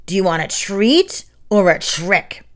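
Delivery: a hint of eerie spider voice; the spider taunts the fleeing boys, cackling. A narrator's reading. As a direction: taunt them playfully. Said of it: "the spider cackled".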